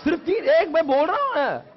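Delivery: high, loud, hammed-up insistence; emphatic exclamation. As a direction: belt it out to convince a doubting class. Insisting on three hours, playfully. (ज़ोर देकर, ऊँची आवाज़ में)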